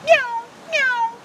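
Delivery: high hungry mewing; high catbird meowing like a cat. Retold or imitated. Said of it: "Catbird sounds like a hungry cat".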